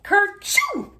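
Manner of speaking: high, loud hammed-up sneeze; big sneeze. A narrator's reading. Robert sneezing at roses on the cake, a loud mock sneeze. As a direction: let the sneeze burst out. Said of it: "went Robert".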